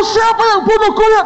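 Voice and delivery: very high, theatrical demanding yell; comic high-pitched outburst; mock angry interrogation. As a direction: demand it like an exploding boss, hammed up. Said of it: "marahnya meledak".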